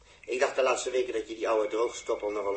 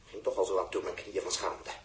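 normal talking voice

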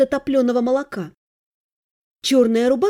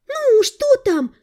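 eager curious; 12 semitones higher, 4 dB louder, slower than usual. Acted. Friends lean in, curious.

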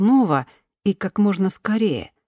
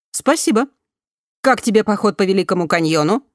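higher, louder, polished newsreader tone; news reader voice; 3 semitones higher, 4 dB louder than usual. A narrator's reading. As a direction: read it like a news bulletin. Голос системы спрашивает. (голос диктора новостей)

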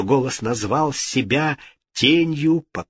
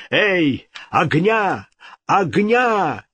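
high, ringing summons; calling out; 7 semitones higher, much slower than usual. A narrator's reading. King summoning servants with candles, calling out.

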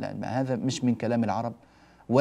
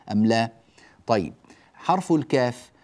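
ordinary delivery

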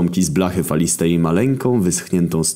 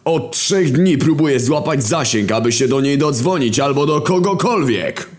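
much higher, half-sung sarcastic rant; War's sing-song rant; 9 semitones higher than usual. Acted. War answering Death, high sing-song mocking rant. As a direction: sing out the swearing like a mocking chant.

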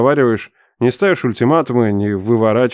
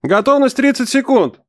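much higher announcer-like call; instructor calling out; 12 semitones higher than usual. A narrator's reading. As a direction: call the countdown to the jumpers. Instructor calls out. (инструктор объявляет отсчёт)

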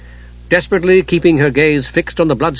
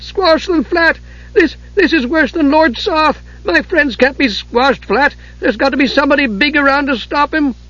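alarmed kender cry; 12 semitones higher than usual. A narrator's reading. Tas crying out as the giant nears.

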